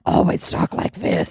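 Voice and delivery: slow mock vigilante voice; Batman. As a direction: imitate the growling hero, slowly, through laughter. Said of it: "to do that voice".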